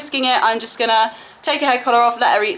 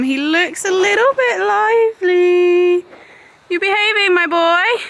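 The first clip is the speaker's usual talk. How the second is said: pet talk; 7 semitones higher than usual. Real, not acted. Talking to her lively horse.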